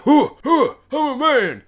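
high grunts into a proud proclamation; mock triumph. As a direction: imitate a boy declaring manhood. Boy after first kill.

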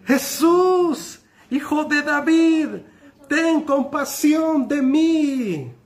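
high, loud desperate plea, the end slow; beggar's loudest shout for mercy. Acted. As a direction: cry out over the crowd. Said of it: "se puso a gritar aún más".

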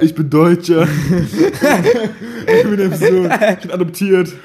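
high-pitched, giggling mock reveal; laughing mock confession. Playing along as [name]'s secret son, laughing high. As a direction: crack up while pretending to confess.

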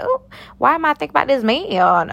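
acted frustration. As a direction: complain to yourself, hammed-up. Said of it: "I was real frustrated".

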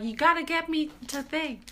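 high mock film quote; film character impression. Host quoting Devil Wears Prada, imitating a character. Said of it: "It's like the one from, like, Devil Wears Prada".

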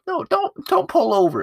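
very high-pitched imitation of a woman objecting; mock female passenger. Woman in the van refusing, high mock voice. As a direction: squeal a refusal in a put-on high voice. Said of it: "and then she's like".

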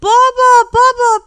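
very high, loud calls for father; child's urgent, ringing cry; excited shout. A narrator's reading. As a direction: yell for dad with big news. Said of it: "و داد زد".

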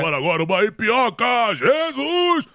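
high comic impression of a sidekick; hammed-up comic sidekick voice; Carioca impression. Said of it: "O Carioca descobriu".

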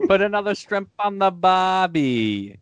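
a playful Australian drawl, slowed; mock Australian accent. Friend teasing the host about being Australian, mock Australian accent. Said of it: "You sound like you're from London".

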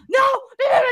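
high, loud mock protest; imitating angry refusal. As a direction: hammer the nos like the irate customer. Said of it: "And he started getting mad".

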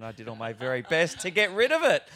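loud, laughing self-mockery; laughing through it. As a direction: laugh it out at your own effort. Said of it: "And I was like".

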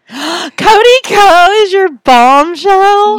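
gasp, then a high, loud, slow disbelieving question; shocked gasp. Real, not acted. To her friend on hearing her last bombshell, a shocked gasp.

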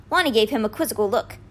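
ordinary delivery, nothing marked

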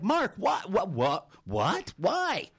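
stammered disbelief, leaping up high; mock-astonished coach; flabbergasted. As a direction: sputter like a stunned coach, ending on a high-pitched why. Coach stunned by swimmer's request.

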